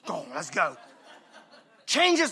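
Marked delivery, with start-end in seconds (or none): 0.1-0.8 low, softer, laughing "let's go"; laughing hurry. Retold or imitated. Preacher as a dad hurrying to his boys, laughing. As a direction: chuckle while rushing out.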